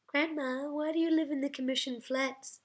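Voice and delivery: put-on high-pitched child voice; mimicking grandchildren. A narrator's reading. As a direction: pipe it up like an inquiring little grandchild. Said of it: "mimicking her grandchildren's voices".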